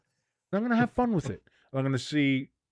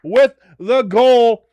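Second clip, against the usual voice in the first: high, loud play-by-play; triumphant commentator; excited goal call; 8 semitones higher, 7 dB louder than usual. Real, not acted. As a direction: announce the scorer at full voice, slowing at the end. Calling the goal just scored.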